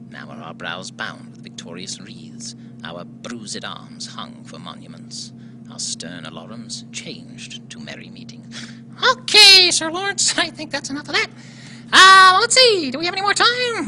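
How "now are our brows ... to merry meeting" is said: whispered dramatic reading. Acted. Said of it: "I had something from Richard the Third prepared".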